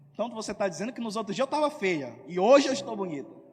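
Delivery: hurt, indignant outburst; indignant retort. Retold or imitated. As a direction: bristle and throw the compliment back. Acting out the offended listener.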